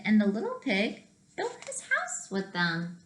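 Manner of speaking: plain reading voice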